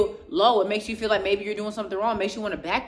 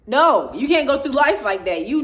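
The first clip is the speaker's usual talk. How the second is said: loud, higher rebuke; raised voice, real exasperation; yelling; 6 semitones higher, 7 dB louder than usual. Real, not acted. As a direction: yell it at them to wake them up. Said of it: "I feel like I'm yelling at you".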